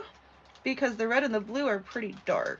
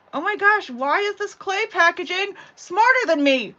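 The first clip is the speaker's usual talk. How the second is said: high, loud exasperated complaint; 9 semitones higher, 9 dB louder than usual. Real, not acted. Crafter fighting with clay packaging, loudly exasperated. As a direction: wail in frustration at a stubborn thing.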